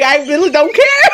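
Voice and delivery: hammed-up weepy protest; tearful denial. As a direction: insist through fake tears, breaking into a laugh. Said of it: "These tears are just coming out".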